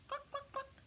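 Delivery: high, soft clucking hen sound; clucking chickens. Acted. Narrator voicing chickens arriving at the party, high clucks.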